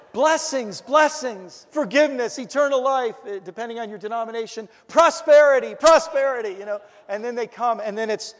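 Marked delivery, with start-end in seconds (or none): 0.1-3.2 high, loud luring cries; calling out. Retold or imitated. Church luring people as one calls a dog, calling out. 4.9-6.5 very loud, high mock luring; calling out. Church luring newcomers with promises, high hollering. Mimics calling the dog, as ministry.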